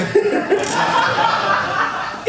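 breathy high giggle; giggling at his own joke; high laugh. Real, not acted. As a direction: laugh off the toad's fate.

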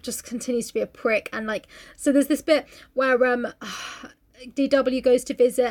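normal talking voice

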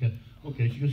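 normal talking voice

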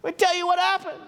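high, eager, hand-waving pupil voice, squealing; nasal-free excited class clown squeal; Arnold Horshack. Retold or imitated. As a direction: squeal like a kid begging to be called on. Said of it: "like Arnold Horshack in class".

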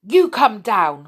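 slow, loud, firm order; commanding. A narrator's reading. Moonface to runaway Connie, commanding. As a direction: order them down, each word weighted. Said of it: "commanded Moonface".

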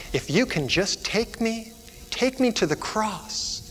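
put-on high, soft, slow child's plea; hushed plea. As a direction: plead softly and slowly as a little girl. Girl's hopeful plea, acted softly.

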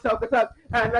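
usual speaking voice

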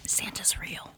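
breathy whispered aside; whispering. Real, not acted. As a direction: whisper it like a secret.

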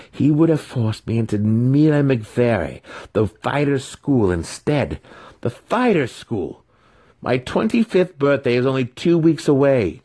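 high, loud sneering villain voice; a bitter sorcerer raging over his ruined plans; scheming villain. Acted. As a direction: rant like a wizard cheated of his due. Villain Massage rants at Alton.

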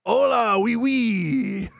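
high-pitched mock Spanish sliding into French; Spanish to French accent. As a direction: slide from hammed-up Spanish into silly French. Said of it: "Spanish to French".

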